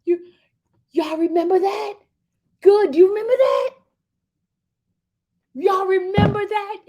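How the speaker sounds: high, loud excited cries; real excitement. Real, not acted. Asking y'all if they remember, wildly excited. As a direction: squeal each question out. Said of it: "I got a little bit excited".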